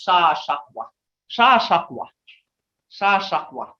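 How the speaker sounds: "Sa Shakwa" with the sh sound in "Shakwa"; correct pronunciation. Shown as a demonstration. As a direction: give the true Muskogee "Sa Shakwa", sh not s. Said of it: "what it really originally is".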